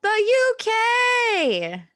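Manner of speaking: very high, very slow, loud and stretched U K; posh grand announcement. Pretend. Host announcing British theme in hammed-up posh voice. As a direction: savour it like a pompous herald.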